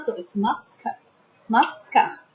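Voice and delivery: quiet teacher drilling "ma, ka"; syllables sounded out. Shown as a demonstration. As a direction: break "muscular" into beats for pupils. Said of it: "We can divide it into three syllables".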